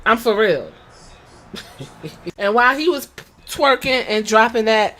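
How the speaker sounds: ordinary delivery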